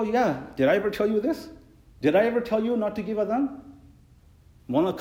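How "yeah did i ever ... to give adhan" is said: high, loud exasperated questions; exasperated retort. Retold or imitated. Imam retorting to the muazzin, high and exasperated. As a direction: push back in disbelief. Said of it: "I go".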